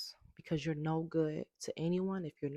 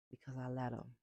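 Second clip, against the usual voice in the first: soft, half to herself; quiet; 3 semitones lower, 5 dB quieter, faster than usual. Real, not acted. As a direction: let it drop quietly, a private admission. Repeats the answer, first softly.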